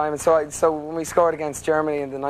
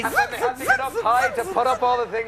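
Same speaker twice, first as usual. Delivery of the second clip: joking; 8 semitones higher than usual. Acted. Joking about his big hair.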